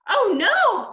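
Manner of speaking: high, loud gasp of horror; dismayed cry. A narrator's reading. Mother hearing about the lost teeth, horrified cry. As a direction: cry out in alarm. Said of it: "my mother cried".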